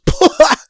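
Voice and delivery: high, loud burst of laughing; burst of laughter. Retold or imitated. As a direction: blurt a sudden laugh. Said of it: "one of the other relatives goes".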